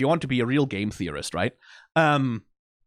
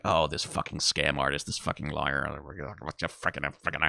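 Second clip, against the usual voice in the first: much lower, much softer gruff grumble; grumbling Husk; 12 semitones lower, 9 dB quieter than usual. Retold or imitated. Reactor voicing Husk's disgust at Alastor's return, low and grumbling. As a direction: mutter it like a fed-up old bartender. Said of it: "Husk is the guy who's like".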